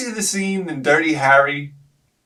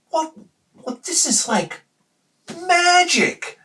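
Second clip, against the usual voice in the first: high, wondering delivery; amazed kid; slower than usual. Retold or imitated. Kid amazed by pirated discs, high wonder. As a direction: marvel at the discs. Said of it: "You're like".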